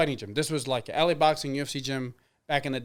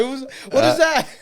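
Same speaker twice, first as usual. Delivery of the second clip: high, loud quote broken by laughter; laughing quote; 9 semitones higher, 7 dB louder than usual. Retold or imitated. Laughing while quoting the Thai coach to friends. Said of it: "He goes like".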